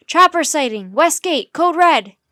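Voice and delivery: loud, high-pitched emergency bulletin; urgent, punchy radio alarm; shouted report. A narrator's reading. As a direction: shout the alert through radio static. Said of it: "the man shouted back".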